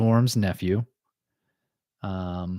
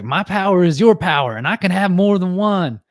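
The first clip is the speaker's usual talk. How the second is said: imitating Rogue; 8 semitones higher, 6 dB louder than usual. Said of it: "And she's like".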